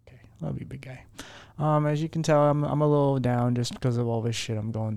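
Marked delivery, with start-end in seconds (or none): 0.1-1.0 whisper to dog. Real, not acted.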